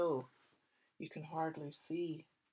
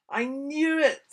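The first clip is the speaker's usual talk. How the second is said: louder, higher, slow; Dad knowingly; 8 semitones higher, 12 dB louder than usual. Acted. Dad on the power cut, loud. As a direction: announce it knowingly. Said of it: "said Dad".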